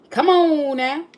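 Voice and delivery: imitated country drawl, loud and high; loud, stretched country-accent impression. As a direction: mimic a country man's drawn-out call. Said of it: "he sounded country".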